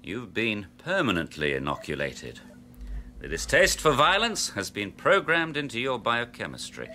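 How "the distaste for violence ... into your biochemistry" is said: ordinary talk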